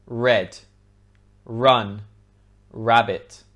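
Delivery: red, run, rabbit with a clear initial R; tongue hovering low for the R in red, run, rabbit; British R. Shown as a demonstration. Teacher showing how to make R, red, run, rabbit clearly articulated. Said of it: "watch my lips".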